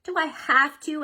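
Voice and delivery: high whiny plea; groaning plea. A narrator's reading. Girl begs mom to skip chores, high and sulky. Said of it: "Ellie asked with a groan".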